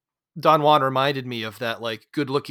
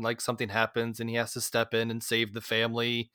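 ordinary talk

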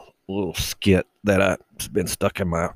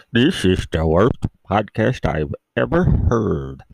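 mock announcer; 3 dB louder than usual.

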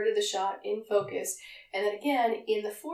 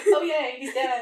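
mock cheer; 3 semitones higher, 8 dB louder than usual. Clones voiced cheering.

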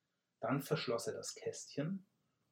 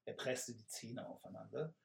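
plain reading voice

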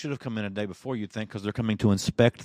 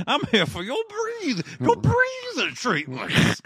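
much higher, slow, hammed-up Southern drawl; a nurse playing a drawling Southern character, pitched way up; mock Southern drawl; 6 dB louder than usual. Respiratory therapist jokes to patients in a hammed-up drawl. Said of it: "he does his best foghorn leghorn".

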